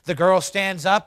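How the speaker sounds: ordinary talk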